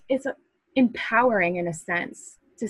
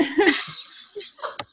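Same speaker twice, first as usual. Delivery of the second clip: unvoiced high laugh; burst of laughter; 12 semitones higher than usual. Real, not acted. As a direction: let out a breathy burst of laughter. Laughing at own story.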